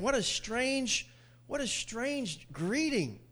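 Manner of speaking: 1 slow wondering. Acted.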